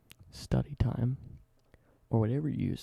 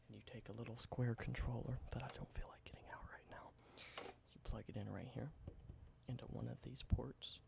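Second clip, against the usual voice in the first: soft, close whispered ASMR roleplay; 10 dB quieter than usual. Acted. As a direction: whisper it gently like showing an old relic. Whispered ASMR show-and-tell roleplay.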